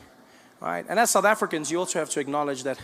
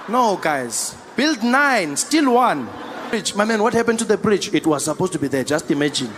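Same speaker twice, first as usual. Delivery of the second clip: much louder, pleading to the crowd; loud exasperated rant; 3 semitones higher, 9 dB louder than usual. Real, not acted. To a South African crowd, a loud laughing plea about corruption. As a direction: shout it to the crowd in disbelief.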